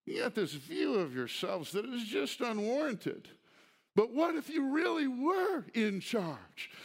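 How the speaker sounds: sarcastic wish to the proud church; sarcasm. Acted. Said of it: "Y'all do see the sarcasm here".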